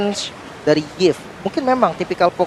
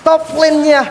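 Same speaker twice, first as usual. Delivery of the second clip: excited; 9 semitones higher than usual. Real, not acted. Caster calling nonstop teamfights.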